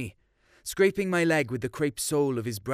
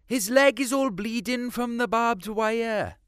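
put-on higher, earnest girlish voice; young girl's voice; 10 semitones higher than usual. Girl telling the farmer the foal is hurt, higher voice. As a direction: lift into a young woman pleading for the foal. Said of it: "they said".

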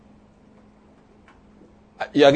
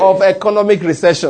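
loud, emphatic declaration; like you mean it; 3 semitones higher, 5 dB louder than usual. Shown as a demonstration. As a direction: proclaim it loudly for the crowd to echo. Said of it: "do it like you mean it".

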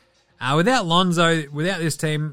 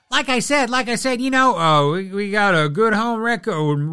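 high, loud mock interview voice; high mimicry of a player in a post-game interview; imitating Towns; 3 semitones higher, 4 dB louder than usual. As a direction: play Towns stumbling through his answer. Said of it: "He's like".

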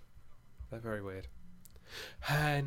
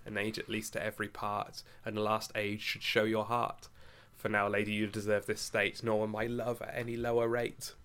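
poem recitation; 2 semitones lower, 5 dB quieter than usual. Acted. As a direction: let each line land gently. Recites To His Coy Mistress.

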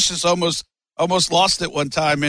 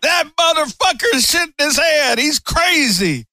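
higher, hammed-up southern drawl imitation; southern accent; 9 semitones higher than usual. Quoting Sid running off from the prank, in a higher hammed-up southern accent. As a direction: do Sid in a southern accent, the last words slowed. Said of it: "With that southern accent".

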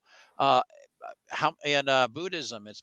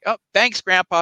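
higher, louder thanks with hollow enthusiasm; feigned gratitude; 5 semitones higher, 8 dB louder than usual. Acted. Grandson thanking grandpa he privately thinks a cheapskate, feigned gratitude.

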